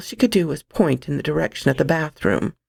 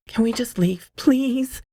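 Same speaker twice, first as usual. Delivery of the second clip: begging; 7 semitones higher than usual. Acted. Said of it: "she begged".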